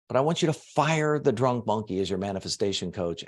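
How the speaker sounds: normal talking voice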